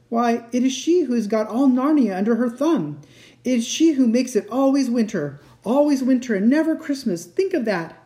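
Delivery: high, agitated explanation; anguished fawn, emphatic. A narrator's reading.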